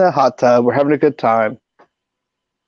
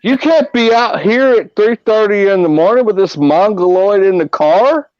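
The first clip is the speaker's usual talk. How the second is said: put-on higher Texas cop voice with a slowed get that boy home; 5 semitones higher than usual. Texas state trooper sending the boys home, higher Southern twang. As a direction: lay on a Southern lawman twang.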